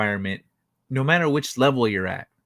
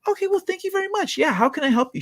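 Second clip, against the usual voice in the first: much higher, fast, sunny and eager; bubbly helpdesk girl; faster than usual. Retold or imitated. Helpdesk worker answering an angry caller, bright and eager. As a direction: chirp it like a delighted call-center agent. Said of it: "she says".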